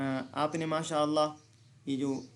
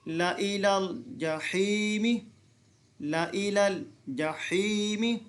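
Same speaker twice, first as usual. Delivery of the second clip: slow chanted recitation, vowels stretched; Quranic recitation; 3 semitones higher, much slower than usual. Shown as a demonstration. Teacher reciting for students to repeat. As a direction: recite it slowly, twice. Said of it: "अब आगे पढ़ेंगे".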